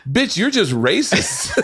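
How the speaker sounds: high, slow mock indignation; imitated indignant outburst. Donnell snapping at the psychic, imitated indignation. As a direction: stretch out a high, laughing mock accusation. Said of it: "And Donnell goes".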